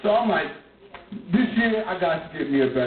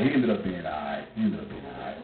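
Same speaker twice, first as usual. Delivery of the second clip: much lower, much softer trailing off; fading away; 14 semitones lower, 6 dB quieter than usual. Real, not acted. Comic repeating the reassurance, fading out quietly. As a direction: let it die away to nothing.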